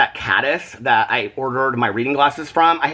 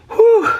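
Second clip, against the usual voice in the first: high whew exhale; 6 dB louder than usual. Real, not acted. Exhausted, catching breath.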